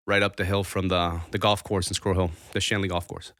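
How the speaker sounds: ordinary talk, nothing marked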